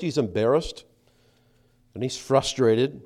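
usual speaking voice

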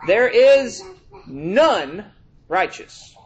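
preacher reading Romans to the congregation; scripture reading. Acted. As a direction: hammer the verse home.

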